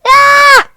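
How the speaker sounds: loud, very high scream of shock; acted shriek. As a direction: shriek at your own reflection. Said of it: "He's going".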